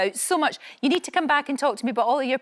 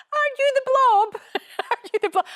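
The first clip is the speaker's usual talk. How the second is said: high, eager imitated question; mock excited question; 13 semitones higher, 4 dB louder than usual. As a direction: playfully squeal the question. Mimicking callers' question.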